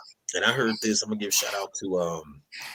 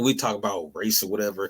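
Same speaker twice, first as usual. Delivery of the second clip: ordinary talk, nothing marked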